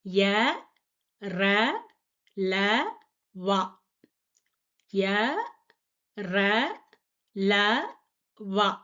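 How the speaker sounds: ய ர ல வா said one at a time, twice; Hindi ya-row recited. Shown as a demonstration. Teacher to students, reciting the easy row. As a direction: recite ய ர ல வா clearly. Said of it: "அடுத்த line எல்லாம் கொஞ்சம் ஈஸி தான்".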